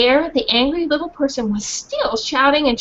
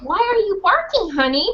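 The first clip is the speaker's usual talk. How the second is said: kind teacher; 7 semitones higher than usual. Acted. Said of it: "said a dark-haired teacher".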